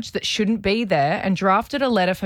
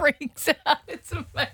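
laughing; 8 semitones higher, 4 dB quieter than usual. Real, not acted. Laughing high squeal.

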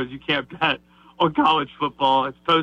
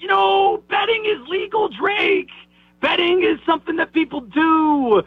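much higher mock-protest voice; mocking imitation; 11 semitones higher than usual. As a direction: mimic the naive defender up high. Mocking defenders of the bettor.

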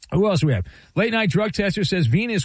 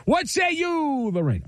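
calling on contestant; 9 semitones higher than usual. Real, not acted. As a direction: toss the question over, stretching it out. Game host calls on contestant.

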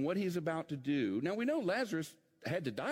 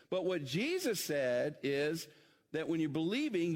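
usual speaking voice

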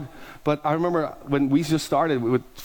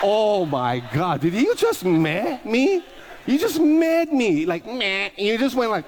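high, slow, incredulous put-on voice; hammed-up disbelief, much higher; mock outrage; 6 semitones higher, slower than usual. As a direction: play up being offended, stretched out. Mock outrage at eye roll.

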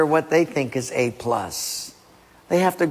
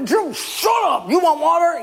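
furious shout; 14 semitones higher than usual. Acted. Acted furious Moses.

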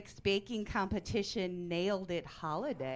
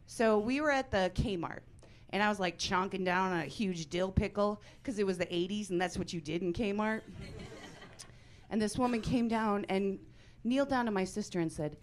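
usual speaking voice

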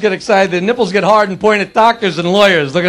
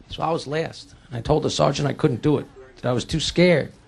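subdued confession; 9 semitones lower, 10 dB quieter than usual. Acted. Soldier son confesses to his father.

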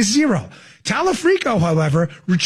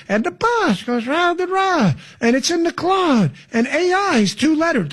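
mock singsong rambling; 6 semitones higher than usual. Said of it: "think about that record compared to this".

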